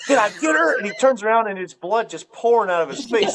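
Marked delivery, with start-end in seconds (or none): 0.1-0.8 high-pitched, eager retold question; mock excited hunter. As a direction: imitate a shooter bursting to know. Voicing shooter right after shot.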